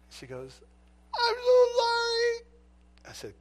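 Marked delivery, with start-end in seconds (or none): 1.1-2.5 high, slow, tearful sorry; crying apology. Retold or imitated. Guilty neighbor apologizing to the officer, tearful. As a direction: sob it out. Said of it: "she's crying".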